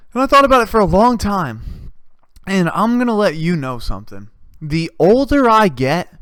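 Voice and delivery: ordinary delivery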